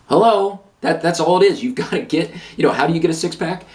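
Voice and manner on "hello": exasperated hello. Real, not acted. Exasperated rhetorical call.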